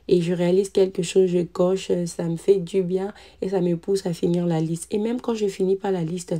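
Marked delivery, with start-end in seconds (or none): none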